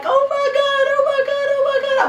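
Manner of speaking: very high, squeaky teary exclamations; overwhelmed happy tears at first sight; tearful oh my God. Retold or imitated. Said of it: "I was just crying".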